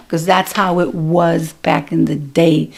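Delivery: ordinary talk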